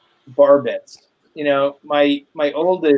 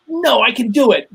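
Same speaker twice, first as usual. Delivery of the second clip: imitating his kid; 8 semitones higher, 3 dB louder than usual. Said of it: "And he goes".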